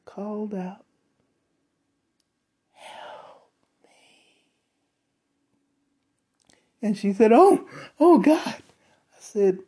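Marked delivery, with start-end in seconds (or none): 2.7-4.5 whispered, drawn-out cry for help; barely audible, like screaming from inside a soundproof box; faint muffled scream. Retold or imitated. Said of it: "and the voice called out". 7.3-8.6 high, loud frightened exclamation; alarmed coworker. Retold or imitated. Coworker hears the voice again.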